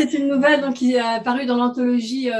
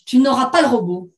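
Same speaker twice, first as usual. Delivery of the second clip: firm, louder refusal; quick retort; 5 dB louder than usual. Acted. Woman to her ex-husband about the robot, quick retort. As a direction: refuse him with no room to argue. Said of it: "répliqua-t-elle du tac au tac".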